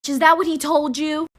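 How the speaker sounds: rising, incredulous repeated challenge; angry retort. Real, not acted. Confronting the girl making videos, angry and incredulous. Angrily confronting the girl's claims.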